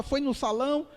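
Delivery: normal talking voice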